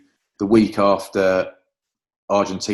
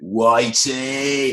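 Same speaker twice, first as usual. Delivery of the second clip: drawn-out greeting; 4 semitones higher than usual. Retold or imitated. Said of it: "he went".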